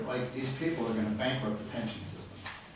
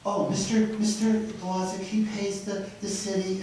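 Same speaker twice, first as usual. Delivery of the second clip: mocking quote; 6 semitones higher than usual. Mocking a councilwoman's words.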